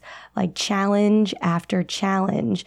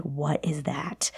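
slow, soft whisper; whispered question; 5 semitones lower, 10 dB quieter, slower than usual. Retold or imitated. Girl asking mom about tampons, hushed whisper. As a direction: ask it slowly with no voice.

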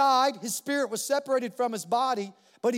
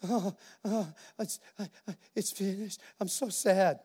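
a hammed-up defeated mumble, lower and much softer; mock mopey; 5 semitones lower, 6 dB quieter than usual. Jesus mocked as moping on the cross, soft mock sadness. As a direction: play a sad sack giving up. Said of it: "he wasn't hanging on the cross going".

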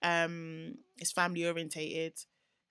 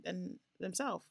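ordinary talk

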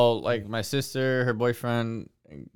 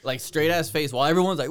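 ordinary delivery

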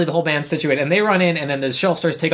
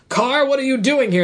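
high, surprised retold exclamation; imitated startled commander; 8 semitones higher than usual. Commander runs into the girl and aunt, acted surprise. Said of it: "Plank runs into Xenon and Judy".

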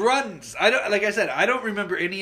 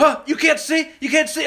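higher, louder alarmed father; alarmed dad; 9 semitones higher, 6 dB louder than usual. Retold or imitated. Dad to his blinded son, alarmed disbelief. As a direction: ask it like a shocked dad. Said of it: "he's like".

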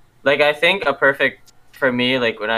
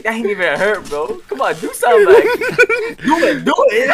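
high, laughing taunt; 14 semitones higher, 5 dB louder than usual. Retold or imitated. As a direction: goad him on, laughing. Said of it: "like, taunting him".

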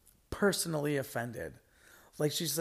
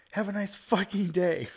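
higher and louder mock yell at the door; retold yell; 7 semitones higher, 6 dB louder than usual. Host retelling the worker yelling at the leaving customer. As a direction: toss it after him as a half-hearted yell. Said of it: "I yelled for him".

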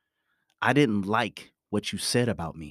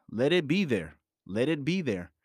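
ordinary delivery

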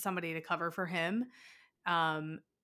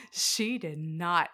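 indignant; 3 dB louder, much slower than usual. Retold or imitated. Outraged retort repeated.

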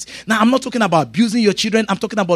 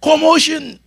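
ordinary talk

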